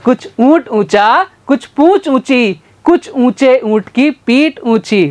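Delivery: careful deliberate tongue twister; slow tongue twister. Shown as a demonstration. Teacher slowly demonstrates the camel tongue twister. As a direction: lay out each word slowly. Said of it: "जल्दी जल्दी पांच बार बोलो".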